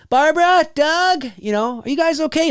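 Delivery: high, worried calling out. Retold or imitated. Neighbor calling to the missing parents, high worried call. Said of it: "You're like".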